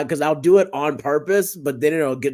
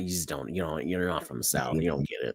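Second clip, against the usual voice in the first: muttered southern comeback; 11 semitones lower, 11 dB quieter than usual. Retold or imitated. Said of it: "I'm just like".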